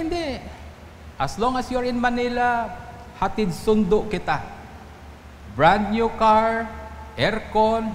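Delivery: high, slow, eager voice of the driver. Retold or imitated. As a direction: play the keen driver offering a ride. Pastor voices the generous driver. (mataas at masiglang boses ng drayber)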